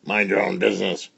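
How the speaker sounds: ordinary delivery, nothing marked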